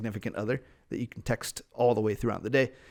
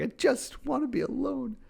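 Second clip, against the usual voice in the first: whiny high voice; 14 semitones higher than usual. Retold or imitated. Imagined wish for space.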